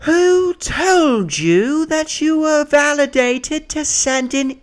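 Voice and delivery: high, hugely drawn-out British demand; slow, indignant mock British voice; British lady. British lady challenging leasing agents, high slow British voice. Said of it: "She had a British accent".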